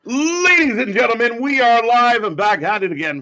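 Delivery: big booming show opener; announcer voice. Acted. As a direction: hype it up like a ring announcer.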